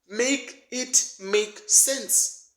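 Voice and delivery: high, slow, drawn-out disbelief; exasperated plea. Real, not acted. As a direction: stretch it out, pleading and fed up. Exasperated rant punchline.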